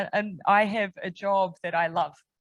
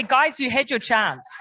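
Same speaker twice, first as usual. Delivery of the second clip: higher and louder, a cheeky dismissive quip; wry mock retort; 5 semitones higher, 7 dB louder than usual. Prime Minister jokingly refusing the UN job, wry mock retort. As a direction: toss it off like a playful brush-off. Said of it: "Do you know what I'd say?".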